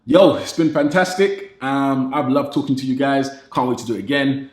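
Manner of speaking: ordinary talk, nothing marked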